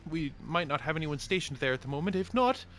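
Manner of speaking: very high, loud sing-song greeting; cheery shopkeeper. Acted. Dwarf shopkeeper greets the party in a high voice. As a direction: greet the customer bright and squeaky. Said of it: "And she says".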